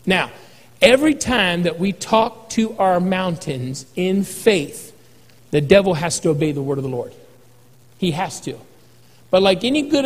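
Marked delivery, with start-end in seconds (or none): none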